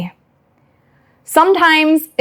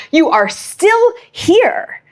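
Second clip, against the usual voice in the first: ordinary talk, nothing marked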